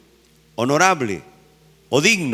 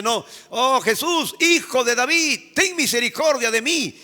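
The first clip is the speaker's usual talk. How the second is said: beggar's plea; 6 semitones higher than usual. Acted. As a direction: beg for mercy like the blind man. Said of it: "él dice".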